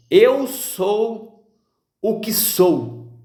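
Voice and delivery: slow, grave divine pronouncement; solemn voice of God. Acted. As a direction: let each word land like God speaking from the mountain. Deus falando a Moisés, solene. (voz solene e lenta de Deus)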